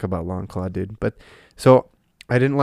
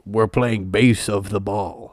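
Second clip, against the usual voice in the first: slow, stilted character imitation; Knuckles; 2 semitones lower, slower than usual. Mocking how the movie character talks, slow stilted delivery. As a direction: spell it out slowly, mock-literal. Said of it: "He doesn't talk like that".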